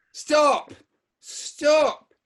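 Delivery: put-on loud high Bradford accent yell; Bradford accent shout. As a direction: yell it in West Yorkshire accent. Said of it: "I'm gonna try my Bradford accent".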